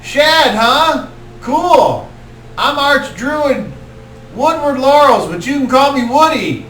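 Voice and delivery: high, loud laid-back stoner; easygoing intro from a stoner; stoner druid. Acted. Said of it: "then he's like".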